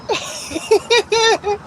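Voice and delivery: high giggling laugh; laughter. Real, not acted. Streamer laughing at chatter's shrooms joke, high giggle.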